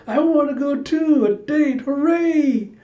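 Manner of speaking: put-on adorable high-pitched little-kid squeal; very high, cutesy childlike excitement; Anya. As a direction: cheer like an excited small child. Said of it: "Anya just looking super adorable".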